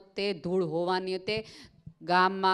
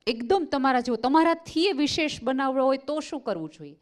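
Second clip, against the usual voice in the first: insistent plea; 6 semitones higher, 4 dB louder than usual. Retold or imitated. Woman presses Einstein.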